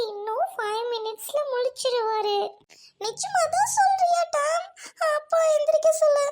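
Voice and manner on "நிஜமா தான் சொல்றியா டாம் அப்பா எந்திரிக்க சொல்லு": pleading. Acted. Scared girl begs father wake.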